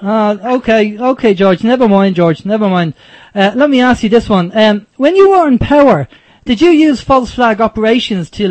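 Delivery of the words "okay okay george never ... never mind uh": usual speaking voice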